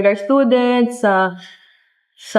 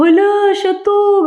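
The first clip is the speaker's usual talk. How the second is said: gasped recognition; 10 semitones higher, much slower than usual. Retold or imitated. Telling kuya how she spotted the coach, a high excited gasp. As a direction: squeal it like you just found him.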